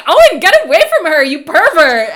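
high, loud scream at the screen; reenacted scream. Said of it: "screaming, like".

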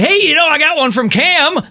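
high, eager quoting voice; imitating John. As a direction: mimic a buddy's excited report. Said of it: "He's always like".

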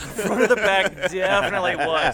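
loud, high echo through laughter; laughing echo. Real, not acted. Co-host echoes friend's joke about the group, laughing loudly. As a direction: crack up while repeating it.

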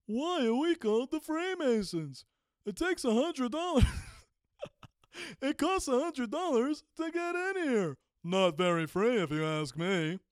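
hammed-up comedian working the punchline; stand-up comic. Imagined stand-up bit for the Freemasons, hammed-up comic voice. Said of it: "I'm gonna get on stage and be like".

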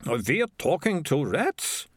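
ordinary delivery, nothing marked

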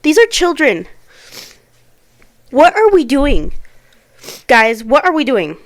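loud, anguished appeal; outraged plea. Real, not acted. Outraged plea about killed children.

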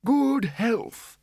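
put-on very high old codger voice; old man toasting. A narrator's reading. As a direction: pipe up a toast as an aged wizard. Old Windle gives a toast.

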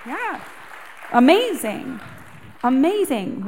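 high, loud bursts of amazement; excited. Real, not acted. Singer thrilled about hitting number three, excited. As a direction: gush at the news.

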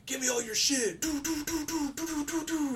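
high squeaky gunfire noises after a casual mugging line; imitating a meme, gunshots voiced up high; mock American mugger. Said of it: "those memes? It was just like".

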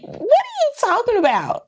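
very high, squealed disbelief; hammed-up high-pitched bafflement; incredulous. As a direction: shoot the pitch way up, astonished. Retold disbelief at being told to apologize.

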